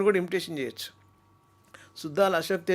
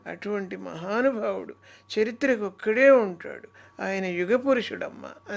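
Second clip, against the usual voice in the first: Lakshmi Parvathi impression; 3 semitones higher, 3 dB louder than usual. Mimic voicing Lakshmi Parvathi praising NTR. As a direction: praise NTR with swelling feeling. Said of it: "ఆ, లక్ష్మీపార్వతి గారు".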